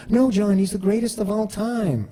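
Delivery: high, eager explaining voice; imitating a coworker setting him straight; mock coworker telling John what goat means.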